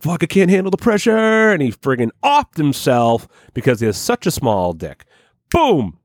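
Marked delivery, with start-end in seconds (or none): 0.0-1.2 high, whiny wail about the pressure; whiny mock despair. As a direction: whine it out like a broken man. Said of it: "he was like".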